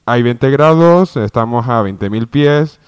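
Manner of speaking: anuncio de cabina, fuerte y pausado; aviso por megafonía. Acted. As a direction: habla a todo el pasaje por el altavoz. (piloto anunciando por megafonía a los pasajeros)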